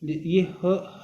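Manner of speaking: ordinary delivery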